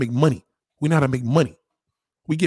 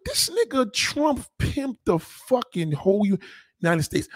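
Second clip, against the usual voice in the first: excited retelling; 8 semitones higher than usual. Retold or imitated. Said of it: "I'm like".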